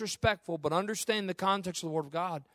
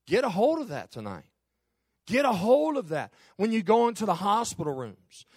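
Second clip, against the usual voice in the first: building to a loud, high sermon climax; preacher's fervent rising exhortation; impassioned preaching; 6 semitones higher, 5 dB louder than usual. Real, not acted. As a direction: drive each repeated phrase higher and louder.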